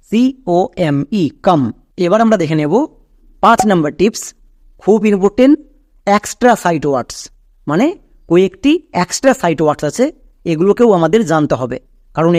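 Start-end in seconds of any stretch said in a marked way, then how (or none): none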